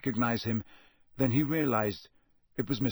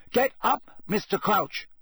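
much higher, louder, clear command; boy urging a dazed man; 9 semitones higher than usual. A narrator's reading. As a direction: speak up so he hears. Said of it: "loudly and clearly".

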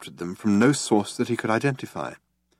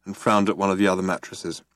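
ordinary delivery, nothing marked

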